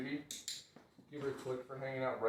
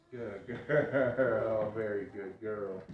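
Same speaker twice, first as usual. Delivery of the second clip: dog praise; 5 dB louder, much slower than usual. Real, not acted. Trainer praising her dog, loud and laughing. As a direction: gush over the dog, laughing.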